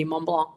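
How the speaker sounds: Mont Blanc said the French way, nasal vowels, no final C; French pronunciation. Shown as a demonstration. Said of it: "your pronunciation is outstanding when you pronounce Mont".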